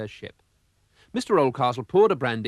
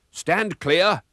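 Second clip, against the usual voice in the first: louder, higher stern warning; 6 semitones higher, 5 dB louder than usual. A narrator's reading. Old man warns advancing pirates, raised stern voice. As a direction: warn the pirates back firmly. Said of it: "warned Mister Oldcastle".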